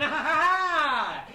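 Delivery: loud, high, drawn-out stage laugh; triumphant reveal laugh. Acted. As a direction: burst out with a theatrical "ha ha ha". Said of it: "the tramp jumped up and went".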